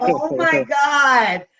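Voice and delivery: loud, drawn-out disbelief; slow, high, exaggerated groan of protest; mock exasperation. Mimics reaction to being teased.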